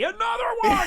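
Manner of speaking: gleeful mock shout. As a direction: holler it like an eager kid. Acts himself begging for another episode.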